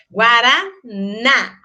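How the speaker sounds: "Guaraná" slowly, with a held open NÁ; strong open final syllable. Shown as a demonstration. Teacher giving an acute accent example, strong open final syllable. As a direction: split off and stress the last syllable of "Guaraná". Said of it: "forte, o aberto".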